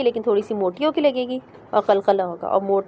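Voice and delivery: ordinary talk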